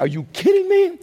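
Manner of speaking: squeaky, high disbelief; incredulous squeak. Acted.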